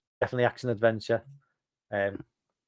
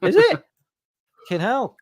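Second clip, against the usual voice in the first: surprised; 12 semitones higher, 6 dB louder than usual. Real, not acted. Shocked at the release date.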